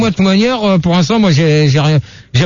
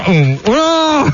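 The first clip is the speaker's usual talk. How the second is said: talking through a sticky candy; chewing sticky toffee; 5 semitones higher than usual. Real, not acted. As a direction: mouth full of sticky toffee. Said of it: "Ah, ça colle aux dents". (la bouche collée par un caramel)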